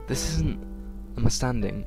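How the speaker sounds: ordinary talk, nothing marked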